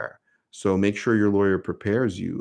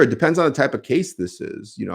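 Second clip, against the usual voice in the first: ordinary delivery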